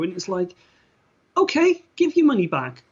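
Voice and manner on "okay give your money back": mock challenge. Mocking reply to regretful actress.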